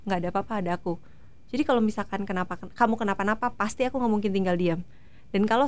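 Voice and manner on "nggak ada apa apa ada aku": low, soft soothing repetition; quietly reassure, unhurried and lowered in pitch; Adi. Retold or imitated. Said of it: "Selalu bilang gitu". (menenangkan pelan dengan nada rendah)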